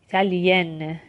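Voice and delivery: 'ایتالین' with the n fully sounded; slow model of 'ایتالین', pronounced n at the end; full final n. Shown as a demonstration. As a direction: finish 'ایتالین' on a clear, released n.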